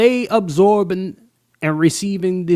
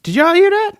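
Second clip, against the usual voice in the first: stunned disbelief; 13 semitones higher, 4 dB louder than usual. Real, not acted. Host stunned by replayed clip.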